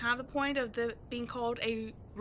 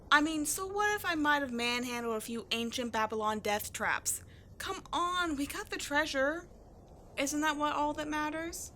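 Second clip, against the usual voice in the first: ordinary delivery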